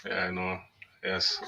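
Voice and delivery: ordinary talk, nothing marked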